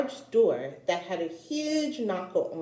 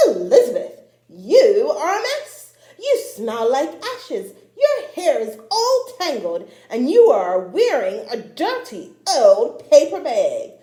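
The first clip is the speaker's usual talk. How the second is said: loud, sneering, laughing put-down; a vain prince mocking her; snooty scorn; 8 dB louder than usual. Prince Ronald scolding Elizabeth's mess, loud and sneering.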